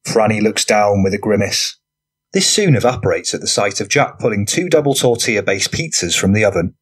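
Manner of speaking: plain reading voice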